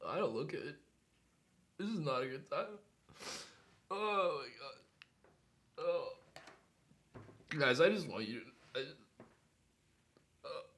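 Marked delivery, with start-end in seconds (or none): 0.1-6.1 weak, strained with pain. Real, not acted. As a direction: groan the words out in pain. Suffering from the hot pepper.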